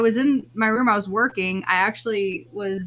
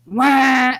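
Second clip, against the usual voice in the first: loud, higher-pitched imitated wail; squawk in another tone; 4 semitones higher, 6 dB louder than usual. Parent retelling the second strange noise, squawk in a different tone. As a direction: imitate a sour, duck-like blare. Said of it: "again, but in a different tone".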